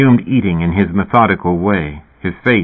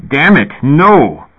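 raised, louder outburst with a drawn-out NO; narrated old man's angry protest; angry exclamation; 7 semitones higher, 5 dB louder, slower than usual. A narrator's reading. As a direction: exclaim it in exasperated fury, stretching the words. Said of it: "Elias exclaimed, and hurled his cup".

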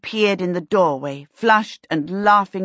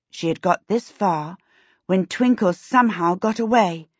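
usual speaking voice

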